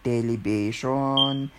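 "television" said as "telebishon"; Filipino-accented "television", B for V. Shown as a demonstration. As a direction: put a B in place of the V in "television". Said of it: "So the letter after you".

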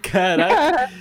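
sudden loud high Caraca; shocked exclamation. Real, not acted. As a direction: blurt it out in shock. Shocked reaction to wild scenario.

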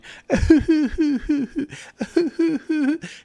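high fake whimpering sob, imitating the son; the son's high, teasing fake sob to make fun of dad; mock crying noise. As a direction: imitate a kid's mocking fake cry. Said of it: "he started making, like, a crying noise".

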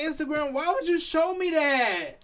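high, slow, loud whiny complaint; exasperated outcry. Retold or imitated. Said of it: "But I'm like".

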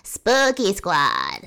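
slow faux transatlantic spooky voice. Shown as a demonstration. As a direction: stretch it out like an old movie host. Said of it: "my spooky voice is like".

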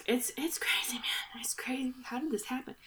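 soft, hushed disbelief; hushed aside. Real, not acted. Telling the co-host the history is crazy, in a hushed aside. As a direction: drop to a near whisper, amazed.

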